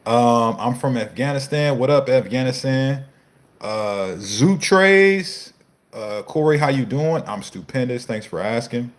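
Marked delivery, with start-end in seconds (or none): none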